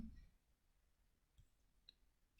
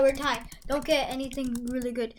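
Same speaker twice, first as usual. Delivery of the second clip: ordinary delivery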